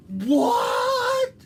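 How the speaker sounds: high, loud shocked cry; surprised shout. Acted. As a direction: shout it out in disbelief. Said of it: "cried Granny".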